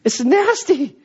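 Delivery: high, squeamish complaint; disgusted guest. Retold or imitated. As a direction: push the plate away. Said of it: "He's like".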